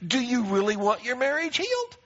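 a sudden odd put-on voice for the question. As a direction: slide into a strange sing-song voice. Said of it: "I don't know why I spoke in that voice".

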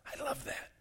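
breathy whisper of delight; hushed aside to the congregation. Real, not acted. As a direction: whisper it like a private joy.

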